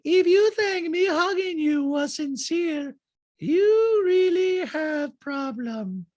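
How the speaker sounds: very high, loud, slow caricature; Diana. Retold or imitated. Housewife telling Sutton off, exaggerated high-pitched impression. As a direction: squeal each word out slowly. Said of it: "And she's, Diana's like".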